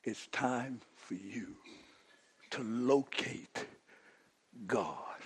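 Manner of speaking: soft, slow and hushed, drawing them in; almost a whisper; hushed slow preaching. Acted. Quiet opening, hushed.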